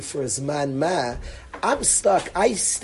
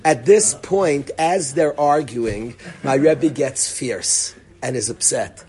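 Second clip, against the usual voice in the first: ordinary talk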